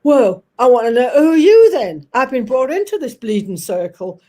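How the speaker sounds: loud, indignant Cockney impression; old Cockney guy. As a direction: play an old Cockney taking offence. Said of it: "we had this old Cockney guy".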